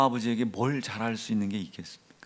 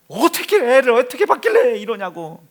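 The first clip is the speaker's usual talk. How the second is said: angry in-law shout; 12 semitones higher, 13 dB louder than usual. Retold or imitated. In-laws blaming the son-in-law.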